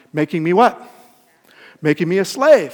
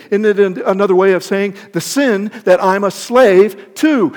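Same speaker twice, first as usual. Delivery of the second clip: loud, pleading preached lament; loud preaching of Paul; 5 semitones higher, 9 dB louder than usual. Acted. Preacher voicing Paul's struggle, loud and anguished.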